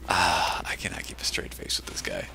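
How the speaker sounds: breathless whisper through laughter. Real, not acted. Laughing at the caster's antics.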